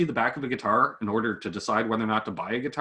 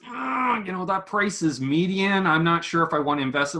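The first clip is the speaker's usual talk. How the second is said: higher, doubtful hedging voice, quickening at the end; hesitant manufacturer; 6 semitones higher than usual. Retold or imitated. Hesitant manufacturer stalling on the reviewer's price, mock doubtful. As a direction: play the unsure buyer who stalls on the price. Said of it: "than he goes".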